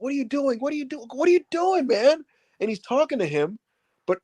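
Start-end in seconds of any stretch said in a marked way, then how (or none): 0.0-2.2 high, incredulous what are you doing; baffled mock plea. Sami baffled as Otis saves Gable, high incredulous. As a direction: disbelief at a friend's choice. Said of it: "he's like".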